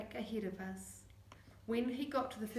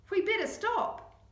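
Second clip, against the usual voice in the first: loud, higher call; urgent loud call; 10 semitones higher, 8 dB louder than usual. Acted. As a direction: call out to the others. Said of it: "called Vinny".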